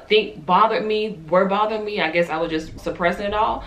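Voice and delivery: ordinary delivery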